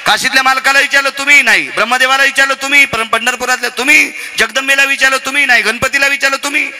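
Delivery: sustained high declamatory questioning; high-pitched preaching. Acted. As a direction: put the question to god after god on one high note. Preacher stages questioning of each god. (उंच स्वरात देवांना विचारलेले कीर्तनी प्रश्न)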